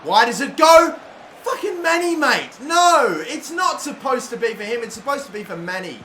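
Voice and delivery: yelling at the game in real frustration; high, loud outraged yell at a bad pass; furious shout. Real, not acted. Gamer yelling at his own player, furious shout.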